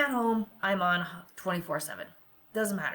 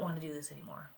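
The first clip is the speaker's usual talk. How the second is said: exhausted whisper; 4 semitones lower, 11 dB quieter, faster than usual. Retold or imitated. Retold despairing thought, whispered.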